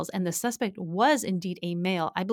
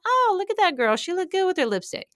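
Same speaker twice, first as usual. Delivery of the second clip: admiring; 10 semitones higher than usual. Retold or imitated. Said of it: "And I thought".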